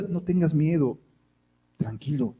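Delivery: soft comforting answer; soothing calm. Acted. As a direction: settle him down tenderly. God's calm reply to the worrier.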